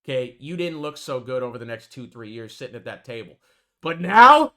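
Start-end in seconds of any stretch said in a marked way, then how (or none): 3.8-4.5 bursts out loud and high; sudden shout. Real, not acted. Calling out the recovery.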